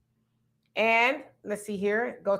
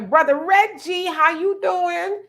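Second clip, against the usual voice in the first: higher and louder, slow stretched hello; excited greeting; 8 semitones higher, 5 dB louder, slower than usual. Real, not acted. Warmly greeting a known viewer.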